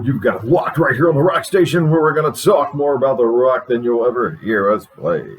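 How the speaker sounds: upbeat rock radio announcer welcoming listeners; radio host. Acted. Host welcomes listeners and guests, radio announcer. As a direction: sell the show like a DJ.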